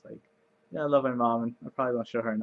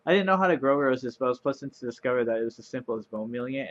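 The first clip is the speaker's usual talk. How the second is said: usual speaking voice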